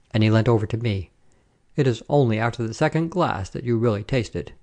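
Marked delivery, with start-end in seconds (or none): none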